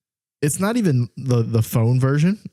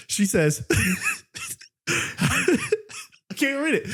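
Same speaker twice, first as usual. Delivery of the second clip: ordinary talk, nothing marked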